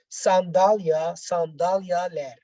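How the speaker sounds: ordinary talk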